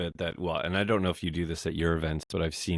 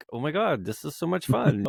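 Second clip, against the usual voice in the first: excited; 9 semitones higher than usual. Retold or imitated. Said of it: "and I was, like".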